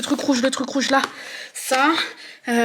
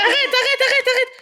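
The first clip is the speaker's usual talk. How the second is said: laughing plea; 13 semitones higher, 4 dB louder than usual. Real, not acted. Begging partner to stop rushing.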